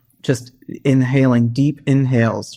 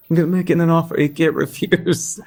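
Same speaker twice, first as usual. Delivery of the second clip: Godfather quote; 5 semitones higher than usual. Retold or imitated. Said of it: "in competition with".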